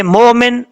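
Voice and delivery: مومن with a long smooth o, no catch; wrong reading without hamza. Shown as a demonstration. Teacher warning of the wrong reading, smooth unbroken مومن. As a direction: run مومن together as mo-min. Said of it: "تو غلط پڑھا جائے گا".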